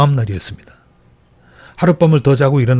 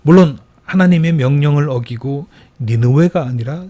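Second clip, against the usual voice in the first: normal talking voice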